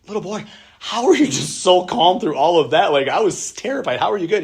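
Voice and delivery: put-on amazed old people. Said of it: "they're like".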